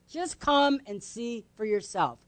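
soft, slow, spaced-out repeat; gentle invitation. Acted. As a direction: invite quietly and without pressure. Same invitation repeated softly.

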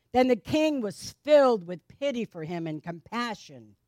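ordinary talk, nothing marked

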